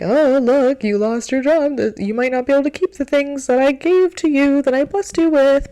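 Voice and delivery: mock devil whispering doubts; devil's taunt. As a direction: taunt like a sly tempter. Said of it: "I might be tricked and thinking, like".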